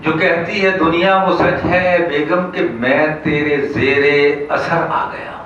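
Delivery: measured, lifted mushaira delivery; comic verse recital. Acted. Poet telling of the boss's order to go home, declaimed. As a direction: build the verse up to its punchline.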